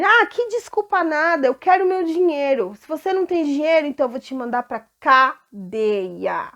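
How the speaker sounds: loud, high demand for the money; angry creditor. Acted. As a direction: grab him by the collar and shout. Servant refuses to forgive. (servo cobrando o amigo com raiva, em voz alta)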